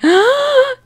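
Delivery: high sharp gasp; shocked gasp. Acted. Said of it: "Alex is like".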